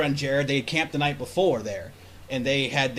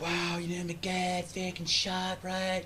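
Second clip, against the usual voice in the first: group's retold chatter; 3 semitones higher, 7 dB quieter, much slower than usual. Imitating tired group driving home.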